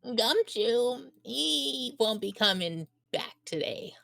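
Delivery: slow, drawn-out bit-part voice; random-character voice. Acted. Reading a random game character who reports Gumshoe is out, in a slow put-on voice. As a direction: put on a silly voice for a minor character. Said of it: "Why is this your go-to voice?".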